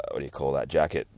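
plain reading voice

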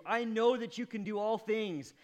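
loud and high, earnest; preacher voicing Job's answer to the Lord; fervent confession. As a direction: proclaim it with full conviction. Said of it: "Job answered the Lord".

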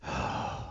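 long quiet breathy sigh; reluctant sigh. Retold or imitated. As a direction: sigh before conceding. Mother admitting she was wrong.

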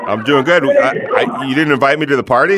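loud, fast playful teasing; mock offended, loud and quick; excited. Real, not acted. Host teases caller about party.

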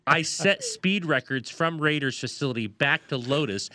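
ordinary talk, nothing marked

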